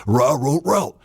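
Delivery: playful dog noises; dog language. Pretend. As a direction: talk like a dog. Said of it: "He's saying".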